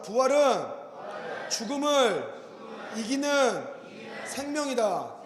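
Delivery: each phrase set apart with pauses, 부활은 죽음을 이기는 생명이다 spaced out; slow call to repeat. Shown as a demonstration. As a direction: say 죽음을, 이기는, 생명이다 one by one for the church to echo. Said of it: "따라자".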